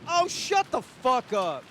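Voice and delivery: high, slow exaggerated snap; hammed-up annoyance; mock angry. Streamer hams up annoyance at the character, high and slow.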